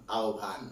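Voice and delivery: আহ্বান said slowly as "ao-bhan"; pronunciation example. Shown as a demonstration. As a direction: say আহ্বান slowly as a model, "ao-bhaan". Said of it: "ওই উচ্চারণগুলো শিখবেন".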